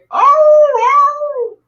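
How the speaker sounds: high, loud hammed-up dog howl "arr, arr"; mock dog howl. Telling a sales story, he mimics the dog's howl: mock high howl. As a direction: howl like a hurt dog, playing it up. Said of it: "Dog's howling again".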